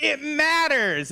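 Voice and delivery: "It matters!" alto e lento, gonfio di finta passione; mock earnest shout. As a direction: shout it slow like a crusader. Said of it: "I remember it's like".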